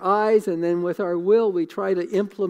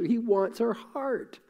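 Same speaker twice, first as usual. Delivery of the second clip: real emotion, slow and softer, voice catching; choked up; 4 semitones higher, 5 dB quieter, slower than usual. Real, not acted. Preacher moved to tears telling the congregation God wants them, slow and soft. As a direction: let the feeling well up as you say it. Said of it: "I don't know why I get emotional".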